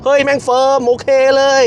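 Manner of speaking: high, loud thrilled outburst; excited exclaim. Real, not acted. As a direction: blurt it in delight. Excited at firm suspension. (อุทานอย่างตื่นเต้น)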